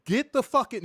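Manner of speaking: loud desperate yell; shouting. Retold or imitated. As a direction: yell for help. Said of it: "I'm like".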